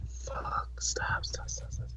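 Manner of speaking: urgent whisper. Retold or imitated. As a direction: plead it in a whisper. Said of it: "I'm like".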